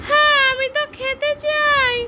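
squeaky high toy voice; high doll voice. Pretend. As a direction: speak as a tiny doll friend. Adult voices toy friend character. (উঁচু পুতুলের গলা)

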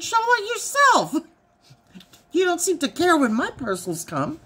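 high, mocking comeback; sassy retort. Wife retorts to husband, high and sassy. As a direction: throw it back at him.

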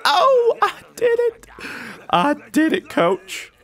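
hammed-up tears of joy; mock happy sob. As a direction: sob out a fake happy cry. Crying-like joy.

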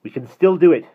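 high, loud urging; urgent encouragement. A narrator's reading. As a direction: push them on, loud and urgent. Running from pursuers, encouraging.

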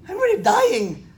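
mock dying patient. Lecturer acts failing heart patient.

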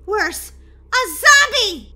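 high, loud, slow alarm; alarmed outburst. Acted. Girl telling her sister what she saw, alarmed shout. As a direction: blurt the horror out loud. Breaks the frightening news.

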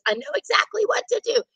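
put-on high, quick and sure old nun; calm, high ancient nun with a plan. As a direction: play the frail nun taking charge. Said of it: "And she says".

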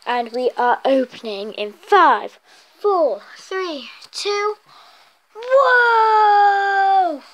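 building countdown into a loud whoa; excited countdown. Real, not acted. Countdown to opening den.

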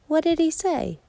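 high, eager cub question; little bear cub, high and eager; curious little cub. Acted. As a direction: squeak it out like a small bear cub.

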